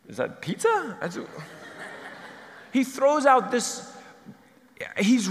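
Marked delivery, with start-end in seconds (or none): 0.1-1.0 high, puzzled question; high bewildered guess; confused. Retold or imitated. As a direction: ask it baffled.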